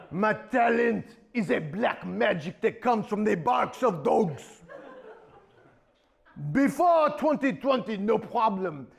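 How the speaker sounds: mock French accent villain; French diva accent. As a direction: purr the threat like a French diva sorceress. Villain Celine Dijon explains her magic.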